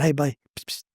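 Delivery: hushed call to the guy behind him; hushed aside. Retold or imitated. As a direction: sneak a psst to the man behind.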